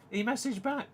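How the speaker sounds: amazed. Real, not acted. Repeated in amazed disbelief.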